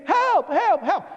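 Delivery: high, quick cries for help; imitated screaming. People piling up under door frames, imitated screams for help.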